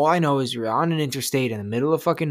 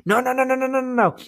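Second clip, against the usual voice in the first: high, rapid-fire string of refusals; pitched way up, frantic recoil; panicked refusal, played for laughs; acted panic; 11 semitones higher, faster than usual. Retelling his panicked scream, recoiling.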